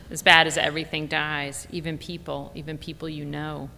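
plain reading voice

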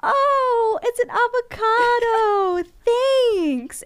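high, loud mock-thrilled kid voice; excited little boy. DJ retells viral video of boy getting avocado, excited. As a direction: imitate a child overjoyed by a gift. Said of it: "he is so excited. He's like".

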